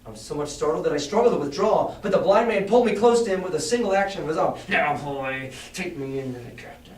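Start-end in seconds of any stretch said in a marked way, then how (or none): 4.7-6.9 cold menacing voice. A narrator's reading. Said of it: "a voice so cruel and cold and ugly".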